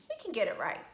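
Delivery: usual speaking voice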